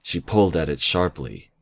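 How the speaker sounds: plain reading voice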